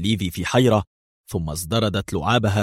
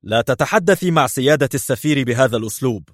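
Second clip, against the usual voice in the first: higher-pitched angry rebuke; indignant, lifted-voice protest; angry shout; 5 semitones higher than usual. A narrator's reading. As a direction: scold her with the voice raised. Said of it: "صاح بها الضخم في غضب".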